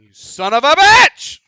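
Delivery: huge high yell, drawn out; explosive drawn-out shout; shouted outburst. Pretend. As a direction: holler the curse slowly at full volume. Sudden outburst during sign-off.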